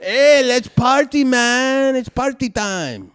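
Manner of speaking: put-on loud, high, slow, partying Russian accent; over-the-top Russian accent shouting to party. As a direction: ham up a drunk Russian at a party. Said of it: "Oh, that's my Russian accent".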